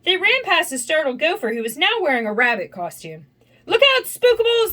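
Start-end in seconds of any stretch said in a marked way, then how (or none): 3.7-4.7 high, loud cry of alarm; high-pitched storybook scream; screamed warning. A narrator's reading. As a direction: shout a panicked warning. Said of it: "they screamed".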